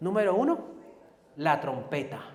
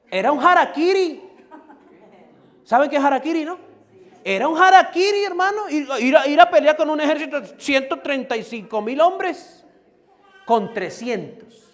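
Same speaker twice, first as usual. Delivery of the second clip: loud, high sermon cry; a fiery preacher's shout, much higher and louder; full-force preaching; 9 semitones higher, 10 dB louder than usual. Acted. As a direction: proclaim it to the whole church.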